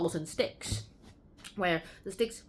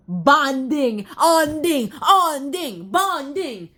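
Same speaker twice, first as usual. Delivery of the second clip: echo effect; 4 semitones higher, 8 dB louder, much slower than usual. Acted. Echoing chapter title.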